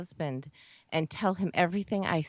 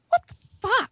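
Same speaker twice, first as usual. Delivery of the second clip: high startled yelp; shocked; 7 dB louder than usual. Retold or imitated. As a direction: blurt it out high and sharp. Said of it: "I'm like".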